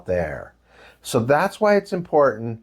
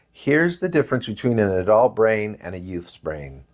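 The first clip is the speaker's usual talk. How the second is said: ordinary talk, nothing marked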